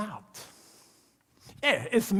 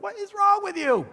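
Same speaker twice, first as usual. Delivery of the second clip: much higher, put-on incredulous cry; baffled prisoners; 13 semitones higher than usual. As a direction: squeak it out in disbelief. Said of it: "people are like".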